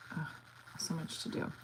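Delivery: under her breath; whispered. Real, not acted. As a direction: murmur it to yourself, voiceless. Muttered then repeated loudly.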